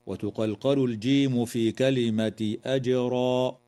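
ordinary talk

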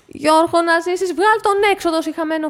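high, loud call to the husband; δυνατή φωνή προς τον άντρα; shouting to husband. Acted. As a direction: shout across the room for help. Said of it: "φώναζε τον άντρα της".

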